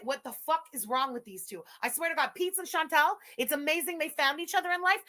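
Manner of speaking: ordinary talk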